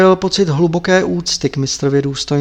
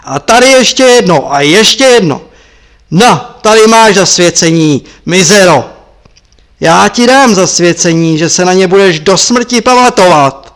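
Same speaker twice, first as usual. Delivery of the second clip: loud raging blows in words; enraged master shouting at his disciple; furious shout; 3 semitones higher, 8 dB louder than usual. Acted. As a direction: roar each line as another blow lands. Marpa beating the monk.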